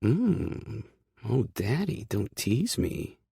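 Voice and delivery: high, slow, coy purr; flirt, playing innocent; sultry tease. Hurricane flirting with Kay, sultry and coy. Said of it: "She flashes me a sultry smile".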